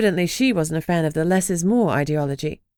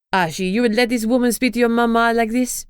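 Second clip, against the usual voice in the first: put-on higher, indignant French accent; indignant French mother; 5 semitones higher than usual. French mother appealing to Archie, indignant. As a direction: appeal to your son, offended, in a French accent.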